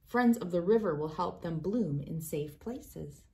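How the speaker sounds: ordinary delivery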